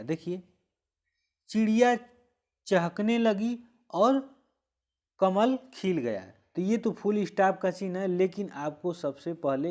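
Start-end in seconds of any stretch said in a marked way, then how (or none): 1.5-6.2 loud, high चिड़िया, pause, चहकने लगी और, pause, कमल खिल गया; deliberate pauses. Shown as a demonstration. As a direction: project each phrase, stopping after चिड़िया and और. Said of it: "मतलब यहाँ भी रुका गया". (ऊँची आवाज़ में, रुक-रुककर)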